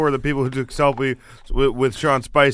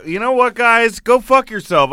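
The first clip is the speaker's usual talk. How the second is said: higher, louder mock rant; mock outburst; 8 semitones higher, 4 dB louder than usual. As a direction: snap at them in fun.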